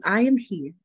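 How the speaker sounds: normal talking voice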